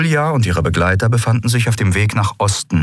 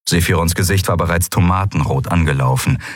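ordinary delivery

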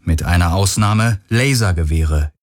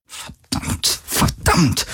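Verdammt tonlos geflüstert, tiefer; gemurmelt; 7 semitones higher, 4 dB quieter, much slower than usual. A narrator's reading. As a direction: das Verdammt vor sich hin hauchen. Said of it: "murmelte sie immer wieder". (die Kapitänin murmelt flüsternd vor sich hin)

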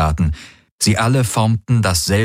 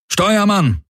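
calling out; 14 semitones higher than usual. Acted. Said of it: "rief der Feldherr".

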